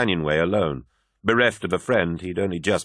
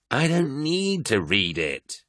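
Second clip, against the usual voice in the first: slow, sharp refusal with a higher pitch; an irritated man refusing, drawn out; snapped; 7 semitones higher, much slower than usual. A narrator's reading. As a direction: bite each word off, slowly. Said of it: "he snapped".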